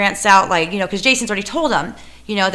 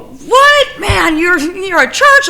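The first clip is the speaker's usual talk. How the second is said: high, loud incredulous squeal; mock disbelief; 11 semitones higher, 8 dB louder, much slower than usual. City guy mocked in disbelief at the church, high squeal. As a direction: shriek it like you can't believe it. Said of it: "he go".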